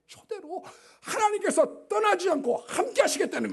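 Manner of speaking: 높고 크게 외치는 설교; 절정에서 힘껏 외치듯; 열정적 설교. Acted. Sermon climax. (하나님의 동행을 높고 크게 외치는 설교)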